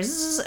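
short soft zzz; quick insect buzz; imitated mosquito buzz. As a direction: mimic a mosquito buzzing at the ear. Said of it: "it was in my ear".

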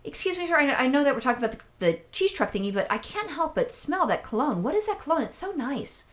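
high, soft, gushing compliment; sweet-talking flattery. Retold or imitated. Customer buttering up a proud man about his cologne, sweet-talking.

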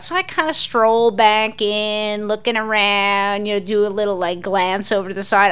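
normal talking voice

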